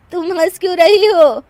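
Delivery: high, loud wailing protest; wounded widow; tearful indignation. Acted. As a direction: reproach her through grief. Mourning widow objects to laughter.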